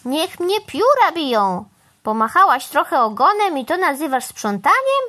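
a touch of squawky indignation; indignant crow. A narrator's reading. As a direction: scoff as a mildly outraged bird.

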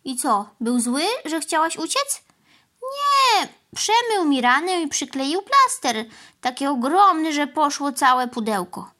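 a hurt cow telling her tale; lightly voiced sorry cow; plaintive cow. A narrator's reading.

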